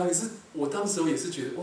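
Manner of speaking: normal talking voice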